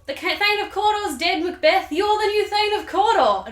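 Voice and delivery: high, loud, jubilant royal announcement; King Duncan. Acted. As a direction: proclaim the good news at full pitch. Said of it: "they come across King Duncan".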